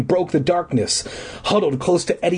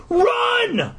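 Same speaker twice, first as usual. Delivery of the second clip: high yelled run; high sudden scream of run. A narrator's reading. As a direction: shout it to your brother. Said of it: "I screamed".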